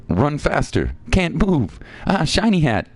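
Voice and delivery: high-pitched critter chatter; little creatures. Acted. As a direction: pipe up like small creatures. Voicing game creatures' dialogue.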